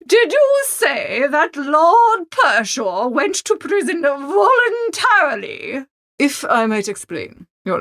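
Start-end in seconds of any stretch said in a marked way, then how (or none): 0.1-5.9 higher, slow, reproachful grande dame; high, deliberate scolding voice of an offended aristocrat; indignant Lady Morvern. Acted. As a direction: look down on him as the haughty aunt, slowly.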